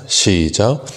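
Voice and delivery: ordinary talk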